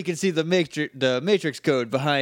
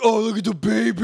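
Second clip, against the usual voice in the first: put-on higher, a little louder, doting over a baby; cooing Rocky; 6 semitones higher, 4 dB louder than usual. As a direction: gush over the baby like a big kid. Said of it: "He's like".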